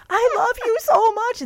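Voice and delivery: very high, loud wailing love plea; imitating the crying boyfriend; mock sobbing plea. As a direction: wail it like a blubbering boyfriend. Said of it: "he's telling me he loves me".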